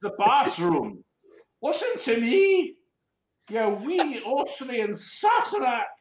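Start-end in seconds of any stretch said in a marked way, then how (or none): 1.6-5.9 high, slow mock Scottish scolding; mock Scottish accent. Podcaster plays Susie in a Scottish accent, high and slow. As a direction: put on a Scottish accent to tell him off. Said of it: "I've got a really weird accent".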